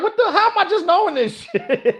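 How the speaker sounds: high, incredulous and laughing; reacting to a friend's hidden blue belt with laughing disbelief. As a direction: act floored that nobody told you. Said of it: "I'm like".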